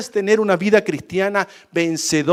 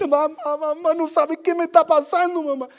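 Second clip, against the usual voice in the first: distraught daughter; 12 semitones higher than usual. Retold or imitated. Crying daughter runs to mother.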